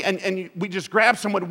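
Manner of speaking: ordinary delivery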